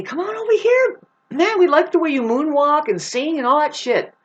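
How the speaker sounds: high, put-on showbiz coaxing; hammed-up talent scout pitch; luring Hollywood voice. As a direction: call them over in a high mock voice.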